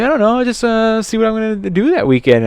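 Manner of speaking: acted high, evasive reply; sheepish hedging. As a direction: hedge it in a high voice. Acts own noncommittal reply.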